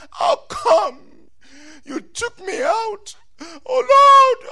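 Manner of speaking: loud, high-pitched mock wailing complaint; hammed-up tearful lament; acted weeping. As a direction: cry out to God, sobbing theatrically. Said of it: "They cried. They wept".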